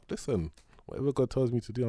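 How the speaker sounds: ordinary delivery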